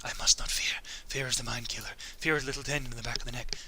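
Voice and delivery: soft, hushed mantra; hushed whisper of Paul reciting against fear; whispered litany. Retold or imitated. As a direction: murmur it under your breath to stay calm. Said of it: "he starts to say".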